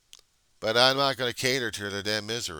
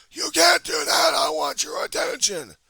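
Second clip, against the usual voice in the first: very high, loud shouted bratty voice; whining heckler; 11 dB louder than usual. Acted. Heckler demanding attention as he walks off, mock shout. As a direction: shriek it like a spoiled brat.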